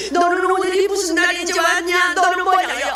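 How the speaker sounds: high, loud acted tirade; angry scolding. Cursing grandmother to the visiting evangelist, high angry shouting. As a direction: berate the intruder at a shouted high pitch. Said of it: "막 호통을 치면서는".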